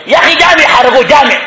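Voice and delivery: high, loud, outraged exclamation; indignant outcry. Real, not acted. Preacher appalled that a mosque was burned, indignant. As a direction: raise the pitch sharply in protest.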